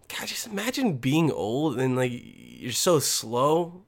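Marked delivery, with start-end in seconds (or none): 0.1-0.3 weary sigh. Real, not acted. 2.1-3.8 very slow, drawn-out mockery; slowed to a crawl to tease old folks; mock slow talk. Mocking slow old people.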